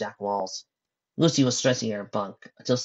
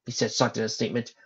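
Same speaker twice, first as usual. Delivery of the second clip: ordinary delivery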